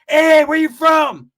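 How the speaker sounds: high, loud, stretched-out holler; yelling out the window. Retold or imitated. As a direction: call out a challenge to strangers in the street. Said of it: "he'll yell out the window".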